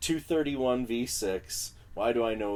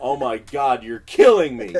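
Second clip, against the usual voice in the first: mock anguish; 4 semitones higher, 13 dB louder than usual. Reacting to engineer cutting tracks.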